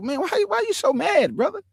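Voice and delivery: high, soothing plea to calm down; calming quote. Retold or imitated. To an angry brother: high, soothing plea to calm down. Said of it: "I'll be like".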